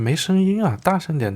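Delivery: high-pitched imitated demand; mock manager pressing the dealer; insistent demand. Retold manager demanding more volume.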